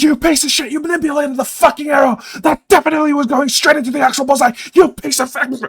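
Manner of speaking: high, shrill furious screaming; furious yelling. Acted. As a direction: scream abuse at him, raging. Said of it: "swearing her fucking head off".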